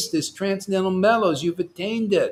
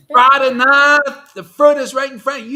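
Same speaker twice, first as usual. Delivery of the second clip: long loud high holler on the name, then quicker; yelling; 7 semitones higher, 11 dB louder than usual. Real, not acted. Teacher hollering a disciple's name to wake him up, yelling. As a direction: call him out at full volume. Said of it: "I have to yell".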